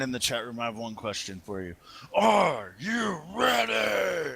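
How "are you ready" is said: drawn-out high announcer yell; hype shout. Acted. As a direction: hype the crowd slowly. Hyping chat room before heat.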